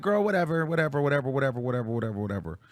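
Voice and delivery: repeated words steadily sinking and fading; weary dismissal. Retold or imitated. Letting them all run with the rumour, fading dismissal.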